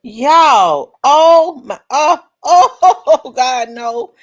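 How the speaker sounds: loud laughing disbelief; shocked excitement. Real, not acted. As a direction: blurt out in amazed laughter. Shocked by something seen.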